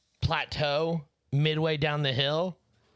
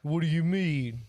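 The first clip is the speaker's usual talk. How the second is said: higher-pitched mock protest; indignant nephew; 4 semitones higher, 4 dB louder than usual. Nephew protesting as he gets scolded, mock indignant. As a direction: imitate a baffled, indignant reply.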